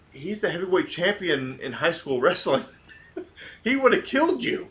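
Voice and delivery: can't hold back the laugh as you warn him; laughing disbelief. Retold or imitated. Son tells dad who the champ was, laughing. Retelling warning while laughing.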